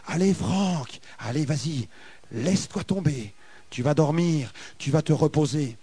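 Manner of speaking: bien plus bas et plus doux, presque un souffle; voix douce qui attire. Retold or imitated. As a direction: susurrer la tentation, lent et feutré. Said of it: "une autre voix qui était douce". (voix basse et feutrée qui pousse à se laisser tomber)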